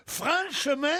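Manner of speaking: exaggerated southern French "Franchement", nasal vowels sounded as "frain-che-main"; mock southern accent. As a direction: say "Franchement" as a comic Midi caricature. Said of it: "où votre accent est le plus comique".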